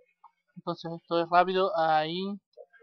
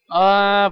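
startled yell; 3 semitones higher, 11 dB louder than usual. Real, not acted. Startled cry while playing.

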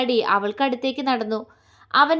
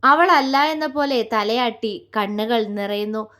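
usual speaking voice

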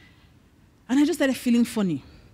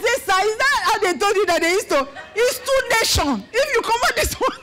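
higher and laughing through tearful words; laughing in disbelief; 8 semitones higher than usual. Real, not acted. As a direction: protest while laughing half in tears. Arguing with doctor, laughing.

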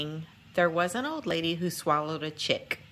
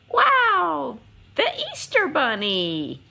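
much higher and louder wow, then the name; excited reveal; 6 semitones higher, 6 dB louder, slower than usual. Acted. Narrator revealing the Easter Bunny to kids, excited and loud. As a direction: burst out with delight at the surprise.

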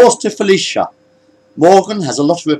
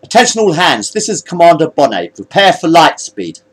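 commanding announcement; 5 semitones higher, 4 dB louder than usual. Acted. Commander addresses all hands.

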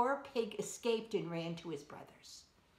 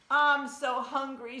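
hungry wolf; 5 semitones higher, 7 dB louder than usual. A narrator's reading. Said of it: "said the wolf".

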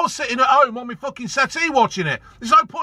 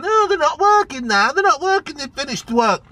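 mocking whiny quote; 5 semitones higher than usual. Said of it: "People are saying".

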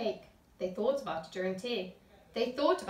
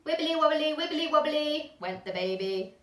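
higher, louder bouncy sing-song; playful refrain; 5 semitones higher, 5 dB louder than usual. A narrator's reading. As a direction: wobble it like a jelly baby.

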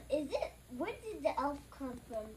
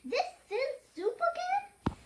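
high, louder mock disbelief; incredulous complaint; 12 semitones higher, 6 dB louder than usual. A narrator's reading. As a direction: protest in a much higher, louder voice. Garth complains about the soup.

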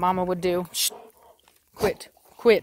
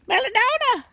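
high loud call; 4 dB louder than usual. Real, not acted. Owner calling a missing dog, high loud call. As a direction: call her name out across the field.

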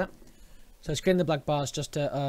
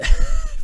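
loud burst of laughter; 18 dB louder than usual. Real, not acted. Sudden burst at own mouse noise.

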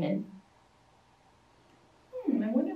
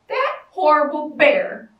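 angry refrain; 7 dB louder than usual. A narrator's reading.

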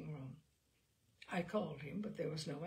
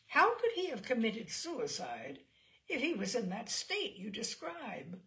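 indignant; 5 semitones higher, 6 dB louder than usual. Acted. Mister Skinner demands answer.

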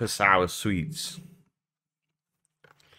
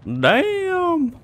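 high loud surprised shout; shocked exclamation; 8 dB louder than usual. Real, not acted. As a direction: blurt it out in shock. Sudden game shock.

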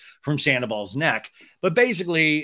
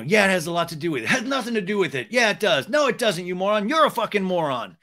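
rapid-fire mock bickering, pitched up; childlike back-and-forth; 5 semitones higher, faster than usual. Shown as a demonstration. Demonstrating how Sandoval repeats back, quick childish bickering. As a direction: rattle off the retorts like squabbling kids. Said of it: "he just repeats back what you say".

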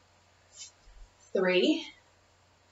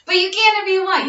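laughing; 10 semitones higher than usual. Real, not acted. Laughs after joking refusal.

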